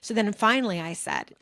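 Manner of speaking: normal talking voice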